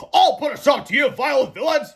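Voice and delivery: high, loud heroic declaration; fearless hero. A narrator's reading. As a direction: proclaim it like a silly superhero. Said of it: "he said fearlessly".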